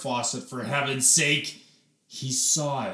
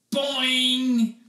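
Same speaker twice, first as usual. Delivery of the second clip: springy boing; 11 semitones higher, 6 dB louder than usual. Acted. Hair springing sound effect.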